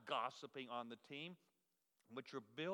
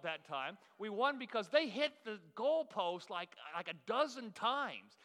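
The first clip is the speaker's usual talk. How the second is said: loud and high, cheering the win; triumphant; 5 semitones higher, 13 dB louder than usual. Acted. Pastor shouting out his team's playoff win, loudly excited. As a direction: crow about the victory to the crowd.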